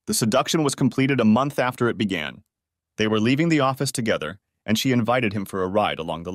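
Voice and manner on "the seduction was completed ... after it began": usual speaking voice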